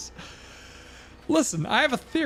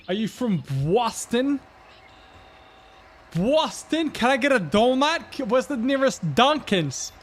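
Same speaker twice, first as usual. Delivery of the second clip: higher, slow mock Boston accent; 6 semitones higher, 3 dB louder than usual. Outsider quizzing a Bostonian, mock Boston accent. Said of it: "Like a Boston accent".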